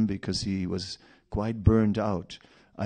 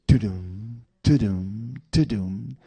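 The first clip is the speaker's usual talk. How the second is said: mock fanfare; 3 dB louder than usual.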